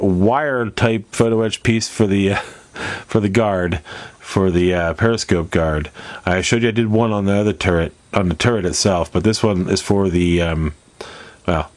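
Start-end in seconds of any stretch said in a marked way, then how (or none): none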